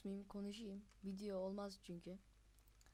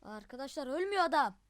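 very loud, higher complaint; exasperated yell; 4 semitones higher, 11 dB louder than usual. Real, not acted. Player telling viewers the enemy won't die, loud exasperated yell. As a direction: shout at the unkillable enemy.

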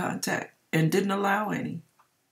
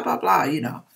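ordinary talk, nothing marked

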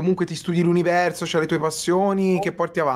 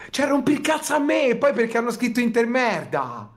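mock outrage; 9 semitones higher than usual. Cohost fake-outraged at chat.

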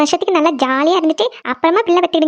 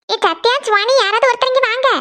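put-on high call to the kids in another room; 7 semitones higher than usual. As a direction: call them from across the house. Mother calling kids from afar. (உரத்த குரலில் கூப்பிடுதல்)

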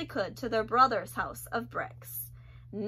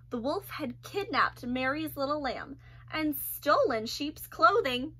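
ordinary delivery, nothing marked

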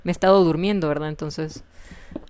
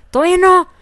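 grito; 10 semitones higher, 7 dB louder, faster than usual. Real, not acted. Jugadora exclama ante el juego.

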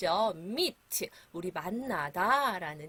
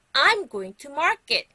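loud, high, very slow sentence; big, raised teacher's call; loud model phrase; 5 semitones higher, 10 dB louder, much slower than usual. Shown as a demonstration. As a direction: project it slowly for the class to repeat. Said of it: "큰 소리로 얘기할 테니까".

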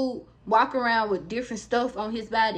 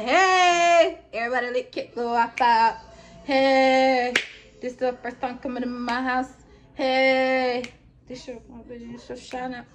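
mocking his greetings; slower than usual. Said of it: "and I be like".

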